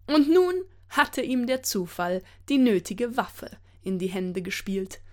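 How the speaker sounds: plain reading voice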